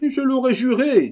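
very high, loud cry; outraged exclamation. Acted. Painter echoing the abbé, high loud outcry. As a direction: burst out at high pitch.

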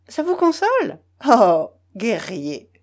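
high teasing mock of a suitor. Zinaida mocks Belovzorov.

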